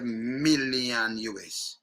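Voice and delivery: MILLION begun on a long hummed M; slow, low MILLION, leaning on the M; drawn-out M. Shown as a demonstration. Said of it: "M sound different from B sound".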